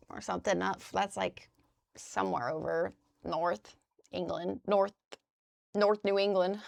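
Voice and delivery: normal talking voice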